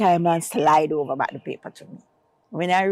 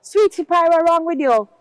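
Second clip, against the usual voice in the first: high, louder imitated gentleman; kind stranger; 10 semitones higher, 6 dB louder than usual. Gentleman on the street asks the crying woman, high imitated voice. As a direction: call out to her like a caring passer-by. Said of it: "this gentleman saw me and said".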